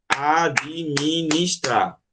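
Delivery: administrar drawn out very slowly, one clap per syllable; clapped syllables. Shown as a demonstration. Teacher clapping out syllables for the class, very slowly. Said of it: "Se a gente fosse bater palma".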